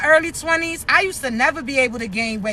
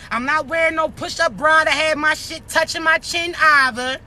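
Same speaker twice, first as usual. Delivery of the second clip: ordinary delivery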